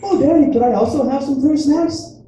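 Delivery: put-on high, pleading kid voice; little daughter's voice. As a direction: imitate a small child sweetly asking Daddy for a treat. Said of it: "come up to me and be like".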